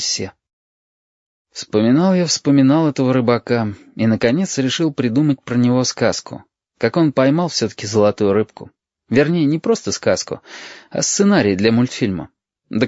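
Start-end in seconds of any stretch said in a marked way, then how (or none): none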